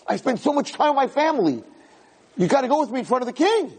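high, fast, desperate begging; soul begging family to come before the king; desperate plea. Acted. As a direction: plead with your family to come along.

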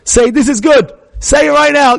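high, forceful command; insistent father. Retold or imitated. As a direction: order the child to say it at once. Father drilling upset daughter.

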